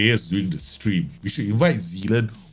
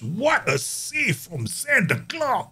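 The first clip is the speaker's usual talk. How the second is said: high, slow awed call of the save; excited commentary; 12 semitones higher, slower than usual. Real, not acted. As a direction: marvel at the keeper. Keeper makes a save.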